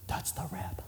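shocked whisper; gossiping guest whispers in shock. Retold or imitated. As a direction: hiss it under your breath in shock. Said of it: "and you go".

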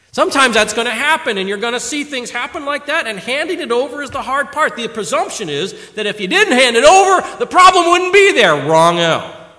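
loud, high, ringing exhortation; full-force preaching. Acted. Preacher exhorting young men and elders, full-force preaching. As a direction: drive it home to the congregation.

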